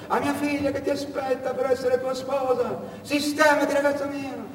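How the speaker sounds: the uncle handing over his savings, scolding; stern old uncle. Retold or imitated. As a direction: lecture the nephew from above. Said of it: "aveva detto".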